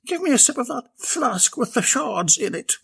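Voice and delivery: high breathless plea; wheezing. Acted. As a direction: beg between wheezes. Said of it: "wheezed Bella".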